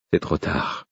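soft, resigned murmur. A narrator's reading. Prisoner tells wolf it is too late, murmuring. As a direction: murmur it under your breath. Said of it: "murmurai-je".

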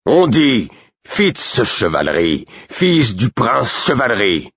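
slow, high, each word hammered in fury; outraged correction. A narrator's reading. Burick corrects the guard, slow outraged insistence. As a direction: spell out the name like a threat. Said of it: "Jamais je n'avais vu Burick aussi outragé".

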